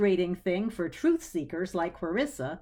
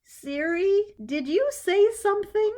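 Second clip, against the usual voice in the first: much higher, rattled voice; startled question; 12 semitones higher, 5 dB louder than usual. A narrator's reading. Clarissa startled, asking Siri if it spoke, high and rattled. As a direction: ask your phone nervously. Startled, asks her phone.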